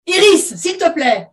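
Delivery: high, loud, slow command; giving an order. Acted. Mother to her daughter, giving an order. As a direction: order firmly, drawing out each word. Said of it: "ordonna Bérengère à sa fille".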